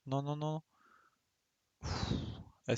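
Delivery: ordinary talk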